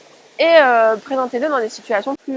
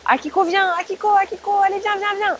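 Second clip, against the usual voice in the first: high, bright call to a dog; 7 semitones higher than usual. Shown as a demonstration. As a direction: call your dog cheerfully, pitched up. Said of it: "Vous pouvez répéter".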